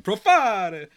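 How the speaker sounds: PROFARE sung out high and loud, middle syllable stretched; drawn-out, showy shout of "Profare"; signature name call. Shown as a demonstration. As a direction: belt "Profare" with a long falling FAR. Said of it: "I don't know why I say it like that".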